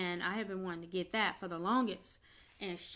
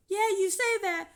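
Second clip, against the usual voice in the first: bitter retort; 10 semitones higher, 7 dB louder than usual. Retold or imitated. Bitter retort while crying.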